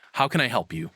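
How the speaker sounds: ordinary talk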